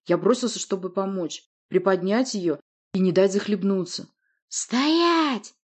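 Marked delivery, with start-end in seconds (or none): 4.5-5.5 high, drawn-out bark; barked command. A narrator's reading. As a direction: bark the order to freeze. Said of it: "рявкнула Власта".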